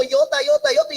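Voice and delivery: mock babble of speaking in tongues; imitating tongues. Pastor imitates a sister praying in tongues, light mock babble. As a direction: repeat the sounds like a prayer in tongues. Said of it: "y era hablando en lenguas".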